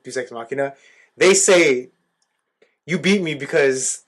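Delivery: normal talking voice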